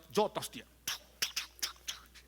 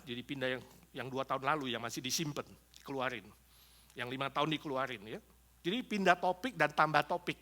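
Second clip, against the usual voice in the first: ordinary talk, nothing marked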